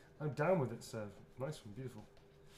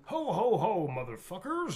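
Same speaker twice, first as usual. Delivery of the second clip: Heisey voice; 6 semitones higher, 6 dB louder than usual. Acted. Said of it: "something that Heisey would say".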